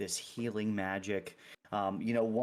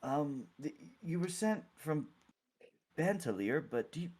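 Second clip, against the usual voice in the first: higher, halting cleric voice; tentative, awkward question in a raised voice; puzzled clergy member; 7 semitones higher than usual. Acted. Clergy member asks the group's name.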